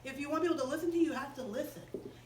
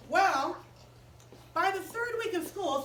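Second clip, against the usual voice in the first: ordinary delivery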